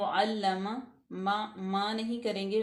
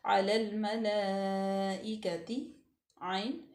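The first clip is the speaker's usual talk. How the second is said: very slow melodic recitation; much slower than usual. Shown as a demonstration. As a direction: chant each syllable out. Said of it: "recitation demo".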